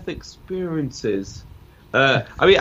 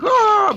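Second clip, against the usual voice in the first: silly high yelp, much louder; stupid noise; 8 dB louder than usual. Retold or imitated. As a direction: squeal like a kid showing off. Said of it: "making stupid noises".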